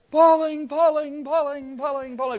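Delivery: high yells that fade as you swing away; fading yells. Retold or imitated. Climber swinging across the valley, yells fading. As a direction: let each cry die off a little.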